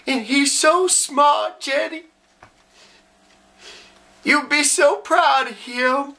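slow drawl at a graveside, rising in pitch with emotion; grieving Southern simple man. Acted. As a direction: talk slowly and haltingly to a lost loved one. Performs a film monologue.

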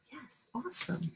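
ordinary delivery

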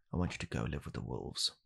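ordinary talk

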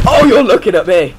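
high, loud cry falling at the end; alarmed exclamation. Real, not acted. Gamer realising enemy spotted them, alarmed shout. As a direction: burst out in sudden alarm.

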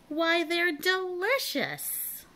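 high, slow delighted cry; excited yell. A narrator's reading. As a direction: burst out as if tasting a treat. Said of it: "jumped up yelling".

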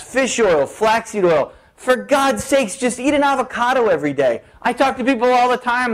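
usual speaking voice

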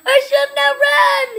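high, loud whiny wail; real despairing wail; wailing lament. Real, not acted. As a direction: moan it out in despair. Repeats lament louder.

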